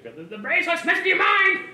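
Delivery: high, loud squeal; squealing goblin voice. Pretend. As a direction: squeal it like a frantic creature. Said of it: "like Squealo, like a Squealer".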